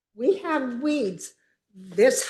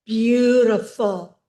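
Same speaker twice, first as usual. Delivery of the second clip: normal talking voice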